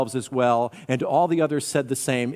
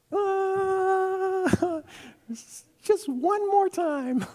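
put-on very high, slow child voice; little slave girl; much slower than usual. Slave girl asking one more time, high little voice. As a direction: ask in a small, hesitant squeak. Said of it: "a little slave girl".

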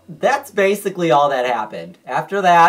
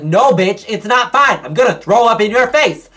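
high, loud and fed up; loud threat; 9 semitones higher, 9 dB louder than usual. Retold or imitated. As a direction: yell it at the nurse. Kid threatening to vomit on nurse.

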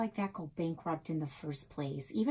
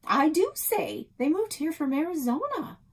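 insistent; 10 semitones higher, 7 dB louder than usual. Acted. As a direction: stand your ground, emphatic. Girl insistent retort.

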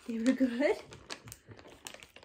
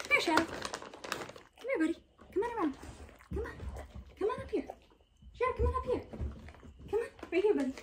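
high, soft sing-song calling the dog over; high coaxing dog voice; 11 semitones higher, 9 dB quieter, faster than usual. Real, not acted. Owner softly calling her dog Shadow up close, in a high coaxing voice. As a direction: coax the dog up gently, voice high.

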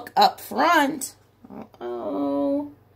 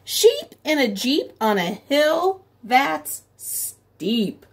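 slow, sing-song read-aloud; storybook reading; 4 semitones higher, much slower than usual. A narrator's reading. Teacher reading Sheep in a Jeep to children, slow sing-song. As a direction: read it slowly so the rhyme lands.

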